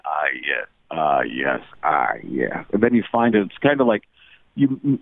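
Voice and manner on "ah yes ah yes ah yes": very low, dark repeated tone; deepest voice. Shown as a demonstration. Impressionist demonstrating starting from his deepest voice, very low. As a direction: push the voice as deep as it goes. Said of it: "going with the deepest voice you can".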